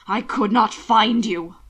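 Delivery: slow, loud anguished outburst; anguished cry of relief; explosion of anguish. A narrator's reading. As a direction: let the words burst out in pain. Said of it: "the words an explosion of anguish".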